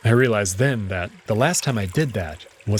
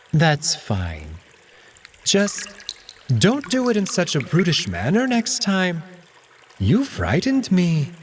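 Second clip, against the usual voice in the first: higher, prim scolding cat voice; a touchy cat telling him off, slow at the end; Alex, offended cat; 8 semitones higher, slower than usual. Acted.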